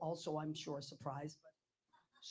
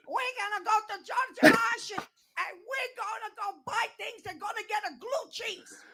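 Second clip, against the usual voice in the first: put-on much higher and louder, slow Italian grandmother accent; grandmother's broken English; 11 dB louder than usual. Grandmother telling granddaughter their plans, broken English. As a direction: sing it out like an old Italian nonna. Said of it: "and she goes".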